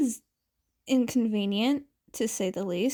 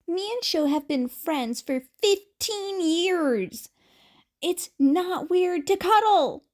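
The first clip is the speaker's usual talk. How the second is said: a hint of a higher, louder Mike, defensive; lightly voiced Present Mike; 6 semitones higher, 3 dB louder than usual. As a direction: lift the voice a little as a protesting Mike. Mike defends cuddling with Aizawa.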